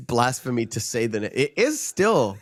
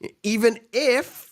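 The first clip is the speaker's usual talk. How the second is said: role quote; 9 semitones higher than usual. Retold or imitated. Quoting official at the stoning.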